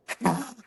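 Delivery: short nervous laugh escaping. Real, not acted. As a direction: laugh out of sudden tension. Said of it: "a huge smile on my face".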